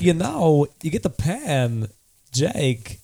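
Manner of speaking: halting delivery with odd pauses; swooping, stop-start cadence; Christopher Walken. Acted. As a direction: speak in broken phrases, each peaking then dropping. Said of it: "it's the most annoying cadence".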